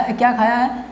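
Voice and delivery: ordinary delivery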